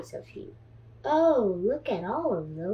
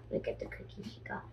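fast whisper; whispered aside; 13 semitones lower, 17 dB quieter, much faster than usual. A narrator's reading. Reader whispering to the children about the cookie. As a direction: hush it quickly to the kids.

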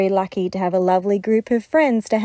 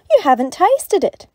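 much higher, louder, coaxing; 9 semitones higher, 7 dB louder than usual. Acted. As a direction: lure him into tasting. Animals urge Dingo.

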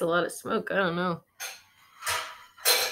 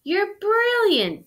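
higher, louder and slow, cheering; 12 semitones higher, 8 dB louder than usual. Acted. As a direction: cheer him on delightedly. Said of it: "Miss Spider cheered".

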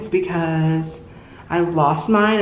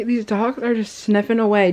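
ordinary talk

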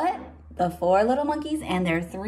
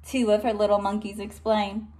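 plain reading voice

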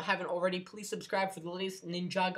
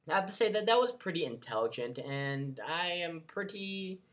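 usual speaking voice

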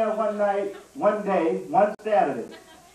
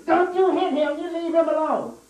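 put-on girl shouting to protect; 8 semitones higher than usual. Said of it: "she jumped up".

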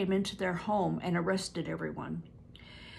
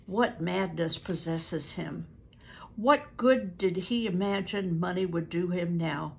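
ordinary delivery

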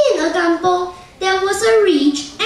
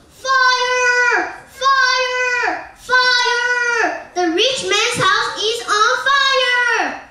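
high, drawn-out alarm call, no louder than the telling; loud shout; 6 semitones higher, much slower than usual. A narrator's reading. Boy raising the alarm about the burning house, mock shouting. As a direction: call out "fire" in long, held, high cries. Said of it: "He shouted loudly".

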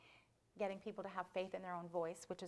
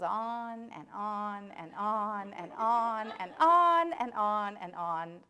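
each "on" stretched long, swelling louder; drawn-out repetition; 12 dB louder, much slower than usual. Acted. Storyteller showing how the mouse goes on, long-drawn chant. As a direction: drag out every "on" endlessly. Acts out endless going on.

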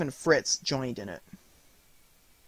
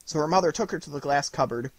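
plain reading voice

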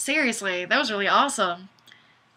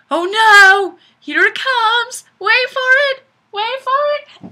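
much higher and louder, breathless build-up; mock alarm; 12 semitones higher, 8 dB louder than usual. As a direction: cry out like the disaster is coming. Reading a dramatic chat comment.

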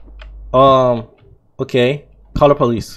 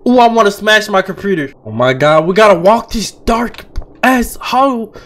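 ordinary talk

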